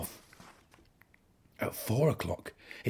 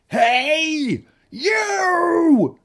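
challenging shout; 12 dB louder than usual. A narrator's reading. Ladybird picks a fight.

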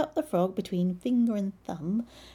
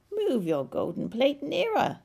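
croaking frog; 7 semitones higher, 3 dB louder than usual. Acted. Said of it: "he croaked".

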